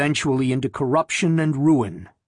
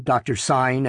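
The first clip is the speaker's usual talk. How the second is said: usual speaking voice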